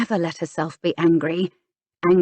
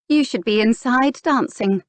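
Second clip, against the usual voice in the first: sanctimonious; 6 semitones higher, 3 dB louder than usual. A narrator's reading. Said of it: "rather sanctimoniously".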